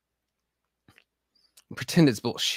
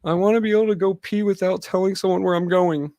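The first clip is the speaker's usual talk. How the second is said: higher put-on voice of a fed-up soldier; mock soldier voice; 9 semitones higher, 4 dB louder than usual. Veterans griping about asking permission to pee, mocking. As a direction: whine it like a tired grunt. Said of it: "just wanna be civilians again".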